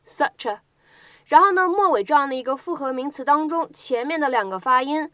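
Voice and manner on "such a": such flowing into a as one word; such a linked. Shown as a demonstration. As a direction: repeat such a with the words joined. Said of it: "可以读成是such a". (such a 连读示范)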